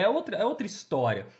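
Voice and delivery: ordinary talk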